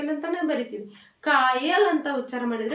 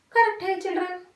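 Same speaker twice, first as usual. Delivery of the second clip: ordinary talk, nothing marked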